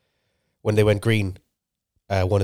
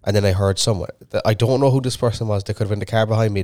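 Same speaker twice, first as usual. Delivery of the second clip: usual speaking voice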